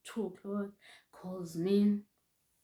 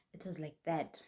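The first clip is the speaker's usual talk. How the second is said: ordinary delivery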